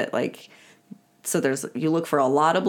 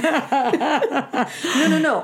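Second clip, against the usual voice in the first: laughing panic; 7 semitones higher, slower than usual. Retold or imitated. Acted flustered quote, laughing.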